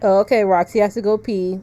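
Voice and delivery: ordinary delivery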